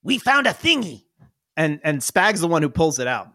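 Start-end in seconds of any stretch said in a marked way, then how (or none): 0.0-1.0 high eager goblin boast; proud goblin. Acted. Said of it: "He goes".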